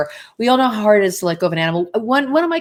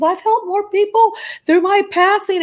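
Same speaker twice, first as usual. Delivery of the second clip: higher, slow, lightly voiced proud cat; 11 semitones higher, slower than usual. The late cat Panzer proudly telling his owner, lightly voiced higher and slow. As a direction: let the cat boast gently, pitched up. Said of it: "He says".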